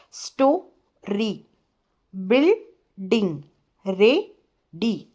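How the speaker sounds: स्टोरी, बिल्डिंग, रेडी each slowly split into two beats; two-syllable breakdown. Shown as a demonstration. Teacher showing children two-syllable words, syllable by syllable. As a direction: break स्टोरी, बिल्डिंग and रेडी as sto-ree, buil-ding, re-dy with clear gaps. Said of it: "टू syllables वाले words बोल रहे हैं".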